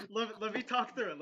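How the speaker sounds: high, loud, laughing plea; laughing protest. Real, not acted. Host pleading with laughing co-hosts to let him finish, laughing protest. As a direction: talk loudly over your own laughter. Trying to speak through own laughter.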